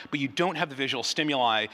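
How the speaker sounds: usual speaking voice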